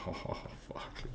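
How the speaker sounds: a breathless, whispered curse; awed whisper. Real, not acted. Streamer spotting a strong chart, whispering in awe. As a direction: let it slip out under your breath.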